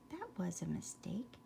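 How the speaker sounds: soft whispered sorry; whispered apology. A narrator's reading. Bambi whispering his apology to the mole.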